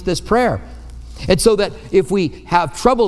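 ordinary delivery

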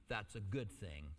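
a hushed, tender God comforting; God speaking gently. Acted. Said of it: "God is saying".

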